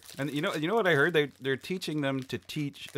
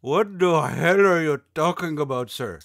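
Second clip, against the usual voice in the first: mock outraged shout; 5 semitones higher, 8 dB louder than usual. Yelling back at a telemarketer.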